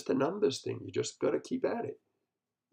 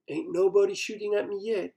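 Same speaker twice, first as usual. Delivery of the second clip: ordinary delivery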